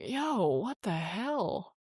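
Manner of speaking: stunned, shaking her head at the night; slow stunned disbelief. Retold or imitated. To herself in the van, slow stunned disbelief.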